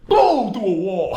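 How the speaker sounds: loud, slow Kool-Aid Man boom; Kool-Aid Man crash. Pretend. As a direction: burst through the wall like the Kool-Aid Man. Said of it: "just gonna Kool-Aid Man".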